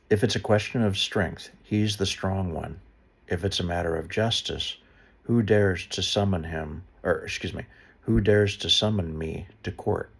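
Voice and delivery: measured, earnest Bible reading; a solemn read of Job, lightly heightened; scripture reading. Acted. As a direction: read the passage out to listeners. Reads the Hebrew version.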